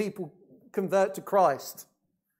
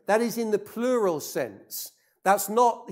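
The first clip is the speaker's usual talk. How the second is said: normal talking voice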